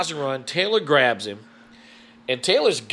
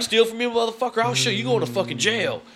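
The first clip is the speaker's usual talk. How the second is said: high raging threat; angry threat; 9 semitones higher than usual. Retold or imitated. Mark about to pounce on the thief, high angry threat. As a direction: go for the thief with rage. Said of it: "Mark's like".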